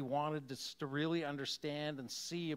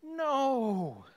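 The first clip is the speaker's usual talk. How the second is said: loud, high gasp of joyful disbelief; shocked no; 10 semitones higher, 9 dB louder than usual. Retold or imitated. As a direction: cry out in stunned surprise. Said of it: "There's a moment of".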